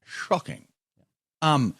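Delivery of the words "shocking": soft mock Scottish brogue; lightly imitated Scottish delivery; Sean Connery. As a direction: do a film spy's Scottish accent, quietly. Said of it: "Scottish brogue there".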